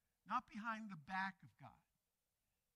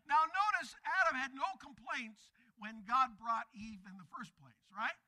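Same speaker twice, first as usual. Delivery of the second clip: ordinary talk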